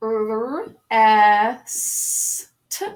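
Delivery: "R, S, T" as long separate phonemes; phonics-teacher "R, S, T" with gaps; single sounds. Shown as a demonstration. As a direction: spell "R, S, T" by sound: rrr, eh-sss, t. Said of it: "let's go over the correct spelling".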